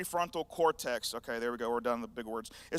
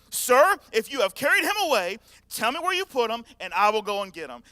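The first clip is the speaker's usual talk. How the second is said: stern Mary; 4 semitones higher, 8 dB louder than usual. Retold or imitated. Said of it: "that's what it is".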